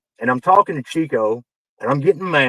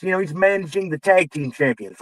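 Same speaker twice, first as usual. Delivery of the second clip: ordinary talk, nothing marked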